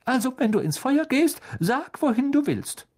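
high, sing-song motherly voice; a kind mother ushering the guest first; fussing mother. A narrator's reading. Mrs Weasley voiced by narrator.

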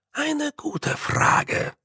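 slow, soft and courteous; a gracious warrior's reply; polite and measured. A narrator's reading. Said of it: "sagte er höflich".

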